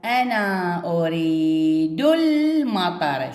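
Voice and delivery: أريد المطر drawn out slowly, ال not sounded; very slow reading. Shown as a demonstration. Teacher shows silent hamzat al-wasl to pupils, very slow. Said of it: "موجودة ولكن ما قرأتها".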